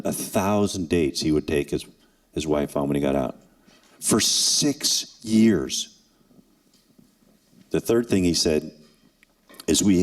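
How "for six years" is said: drawn-out emphasis. Real, not acted. Emphasising length of captivity.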